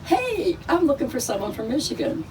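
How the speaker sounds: high, quick call across the crowd. Retold or imitated. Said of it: "And I went".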